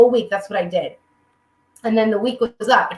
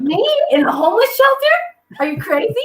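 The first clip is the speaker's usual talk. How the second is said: much higher, rising, appalled question; indignant outrage; 12 semitones higher than usual. Retold or imitated. Complaining to mom about the homeless shelter, pitched up and appalled. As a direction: act utterly scandalized, pitch shooting up.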